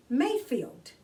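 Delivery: plain reading voice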